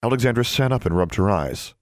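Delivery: plain reading voice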